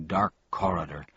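usual speaking voice

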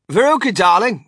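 high, loud motherly call; loud interruption. A narrator's reading. Mother calling her daughter off Wonka, loud interruption. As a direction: cut in over the argument, high and loud.

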